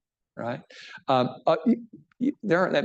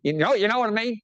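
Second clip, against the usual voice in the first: higher-pitched mock imitation of an old teacher; imitating old professor Don; 8 semitones higher than usual. Pastor recalling old church-history teacher Don, imitating him in a higher voice. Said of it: "he kinda talked like this".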